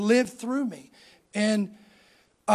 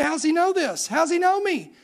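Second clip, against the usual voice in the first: higher-pitched, baffled disbelief; blown away; 6 semitones higher than usual. Retold or imitated. Young man wondering how the preacher knows him, astonished. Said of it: "This is blowing me away".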